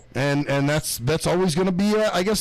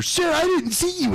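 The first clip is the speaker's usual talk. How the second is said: much higher, slow, put-upon whine; mock indignant driver; 10 semitones higher, slower than usual. Mocking the driver who left her door open, indignant whine. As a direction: imitate her huffy excuse, dragging it out. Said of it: "she did her".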